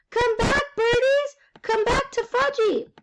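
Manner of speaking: put-on high-pitched little boy yell; screaming child. A narrator's reading. Little boy chasing pigeons, screaming very high. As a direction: shriek after escaping birds as a toddler. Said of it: "was screaming".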